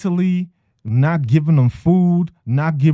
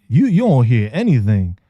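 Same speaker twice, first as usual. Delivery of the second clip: normal talking voice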